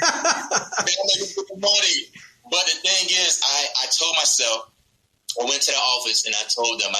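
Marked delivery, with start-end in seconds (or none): 0.0-2.0 high, slow laughing brag; amused, laughing mock pride; laughing boast. Real, not acted. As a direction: laugh through a mock boast about big money. Laughing about an eight-dollar raise.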